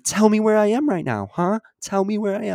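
imitating a son yelling at his mother on the phone; retold yell. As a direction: belt it like the son losing it on the phone.

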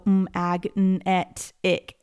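magnetic chopped into single sounds. Shown as a demonstration. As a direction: split the word into separate sounds. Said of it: "I don't wanna go".

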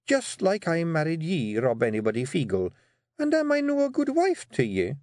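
pointed Scottish wife. Acted. Wife asks Rob if she is good, pointedly. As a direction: lead him into the trap.

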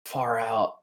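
a lightly voiced dazed stoner, drifting up in pitch; stoner wonder. Santiago gawks at the cave, a hint of stoned awe. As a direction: marvel at glowing mushrooms, spaced out. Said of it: "he's just like".

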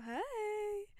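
high, bright-voiced phone greeting; a high sing-song hi to an unknown caller; girly phone hi. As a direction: chirp a girly hello down the phone. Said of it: "'Cause I'm a wee girly".

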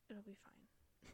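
slow unvoiced whisper. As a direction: hush it out slowly. Reassures, repeats the line.

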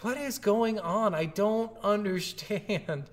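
high, slow, drawn-out confusion; mock bewilderment. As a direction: act bewildered, stretching the words up high. Acted bewildered player reaction.

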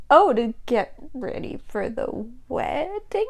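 slow, soft, halting delivery; hesitant question. Acted. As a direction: ask it quietly, dreading the answer. Acted hesitant question to rival.

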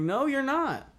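very high-pitched disbelief; acted-out scoffing denial; incredulous contradiction. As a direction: call out an obvious lie, pitch shooting up. Retells rejecting the intruder's excuse.

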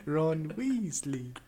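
high, very slow mock-British; drawn-out playful British voice; British accent. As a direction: stretch it in a hammed-up British accent. Trading Harry Potter accent impressions.

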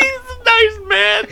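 high, slow words squeezed through laughing; real laughing fit, stretched words; helpless laughter. Real, not acted. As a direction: squeal it out while cracking up. Helplessly laughing at the rat insult.

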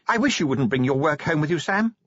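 high-pitched character voice; Sybil's plea. A narrator's reading. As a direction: lift the wish high, rising on the name. Sybil gently chides her husband.

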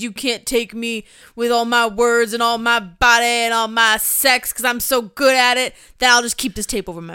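loud, boastful wrestler impression; hammed-up macho promo voice; mock wrestler promo. Co-host mocks the censored wrestler bragging, loud hammed-up boast.

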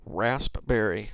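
slow "Raspberry" with the P popped; careful rasp-berry, P pronounced; P sounded. Shown as a demonstration. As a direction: split "Raspberry" after a clearly released P. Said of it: "Do you say it with the P".

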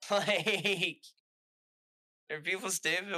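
can you believe these people; exasperated. Real, not acted. Venting to a coworker about thieves, exasperated.

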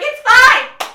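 very loud, high cry; loud teary protest. Retold or imitated. Telling her mom not to worry, very loud and high. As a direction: blurt it out at full volume. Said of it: "I'm like".

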